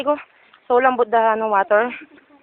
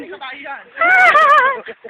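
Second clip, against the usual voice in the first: shrieking laugh; 14 semitones higher than usual. Real, not acted. Laugh after startle.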